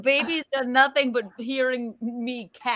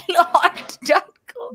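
higher, playful Irish brogue; mock Irish accent; 5 semitones higher, 4 dB louder than usual. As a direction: put on a higher Irish lilt. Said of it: "how you talk in an Irish accent".

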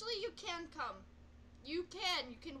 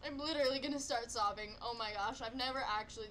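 ordinary delivery

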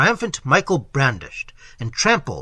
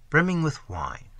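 plain reading voice

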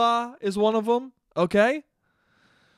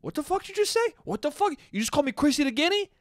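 mock indignant outrage; 8 semitones higher, faster than usual. Cuomo voiced reacting to insult.

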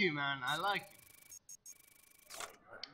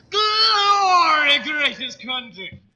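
very high, loud bellow, slowing on greatest country; hammed-up patriotic shout; patriotic cry; 9 semitones higher, 13 dB louder, much slower than usual. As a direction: roar the motto like a rally cry.

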